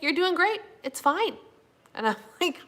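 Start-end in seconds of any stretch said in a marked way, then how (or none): 0.0-1.4 high, gentle encouragement; reassuring. Retold or imitated. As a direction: reassure her kindly. Voicing supportive coach Mindy.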